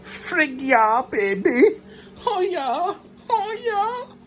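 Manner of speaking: high, thrilled squealing cheers; excited squeal. Real, not acted. Man thrilled as his turbo engine fires, high squeals. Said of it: "but I'm kind of excited".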